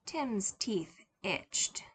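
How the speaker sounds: ordinary delivery, nothing marked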